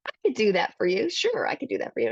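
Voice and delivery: high, quick eager agreement; eager pizza guy. Retold or imitated. Pizza guy agreeing to dad on the phone, high and eager. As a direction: chirp it back like an eager counter guy.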